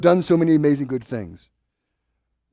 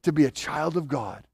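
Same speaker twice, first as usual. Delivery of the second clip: trusting prayer; 8 semitones higher, 4 dB louder than usual. Retold or imitated. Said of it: "Piety is".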